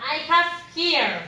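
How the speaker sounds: normal talking voice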